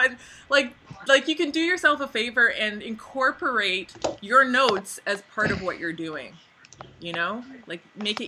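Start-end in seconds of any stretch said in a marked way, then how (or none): none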